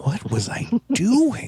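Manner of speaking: high, slow, baffled question; confused. Retold or imitated. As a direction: sound lost and dazed. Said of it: "It's like".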